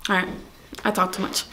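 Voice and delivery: ordinary talk, nothing marked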